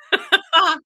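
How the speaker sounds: high, loud short laugh; a bright self-mocking laugh. Real, not acted. Laughs at buying yarn.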